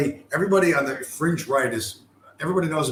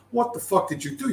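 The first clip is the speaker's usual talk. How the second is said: outraged frog; 7 semitones higher than usual. Acted. Stung frog reacts in fable.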